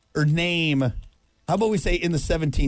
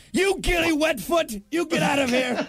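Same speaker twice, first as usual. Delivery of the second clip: mock angry shout; 10 semitones higher than usual. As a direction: chase him off like a fuming lord. Acting out old insults.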